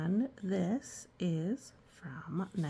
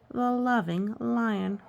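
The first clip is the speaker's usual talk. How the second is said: ordinary delivery, nothing marked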